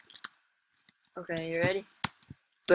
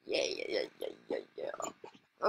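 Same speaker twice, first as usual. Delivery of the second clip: low muttered yeahs; 7 dB quieter than usual. Real, not acted. Talking to himself during the match, low mutter. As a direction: mutter it low under your breath.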